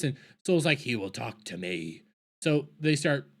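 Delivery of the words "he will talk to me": low, soft and sure, mock Jedi gravity; quiet certainty. Soul telling the Jedi he will talk, low and sure. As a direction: say it low like it's already settled. Soul's quoted confident line.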